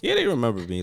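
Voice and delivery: ordinary talk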